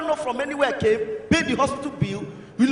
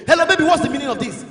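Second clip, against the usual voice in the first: mock angry raised voice on the phone; provoked boyfriend; 2 semitones higher, 3 dB louder than usual. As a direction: demand an explanation, loudly. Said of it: "they provoke".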